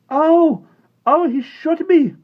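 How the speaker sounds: high, loud pained cry; screaming boy. A narrator's reading. Patrick screaming after being shot. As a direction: scream in shock and pain. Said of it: "Patrick screamed".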